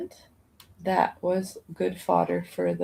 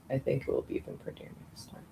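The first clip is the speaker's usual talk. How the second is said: whisper; 7 semitones lower, 11 dB quieter, much faster than usual. Pretend. Whispered mock line.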